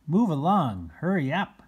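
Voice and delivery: ordinary delivery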